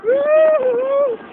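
high loud whoop; high scream. Real, not acted. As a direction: shriek at the drop. Drop on the ride. (grito agudo)

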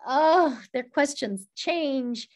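usual speaking voice

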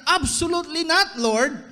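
high, loud, flat refusal; emphatic refusal. Acted. Peter balking at Jesus, loud emphatic no. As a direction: Peter refusing his master outright. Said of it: "Parang sinasabi niya".

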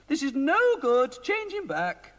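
high, fussy queenly voice; a worried queen pleading with the wizard. A narrator's reading. Queen complaining to Mister Ben about the king, high queenly voice.

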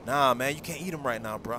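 ordinary delivery

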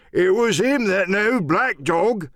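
high, slow pirate cry; drawn-out salty indignation; old seaman Morgan, accusing. Acted. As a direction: accuse him like an old sailor.

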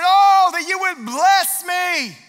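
high, loud, slow shouted plea; pleading cry. Acted. Preacher voicing Jabez's dream-filled prayer. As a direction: lift it up like a desperate prayer. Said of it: "And then he said".

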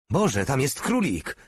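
understated excited exclamation; excited cry. A narrator's reading. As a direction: cry out on spotting something. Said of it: "krzyknął Porta".

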